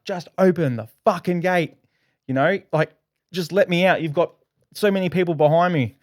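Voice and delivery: mock yell at the machine to open the gate; retold yelling. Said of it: "So I'm yelling at the screen".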